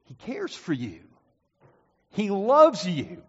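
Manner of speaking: usual speaking voice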